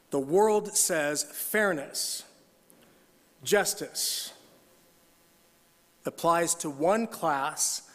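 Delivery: slow, deliberate reading, each phrase set apart; read carefully. Shown as a demonstration. Pastor rereads the line slowly so the church hears it. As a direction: weigh every word slowly. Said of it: "Let me read this one carefully".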